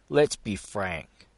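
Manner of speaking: slow, firmer delivery; slow down and lay it out; grave and deliberate. A narrator's reading. Gus getting serious with Fenton, slow and grave.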